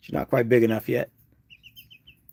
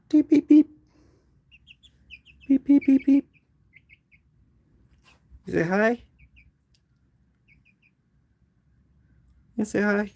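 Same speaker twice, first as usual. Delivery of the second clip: calling a chick; 10 semitones higher than usual. Real, not acted.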